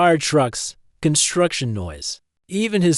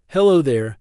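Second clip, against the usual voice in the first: plain reading voice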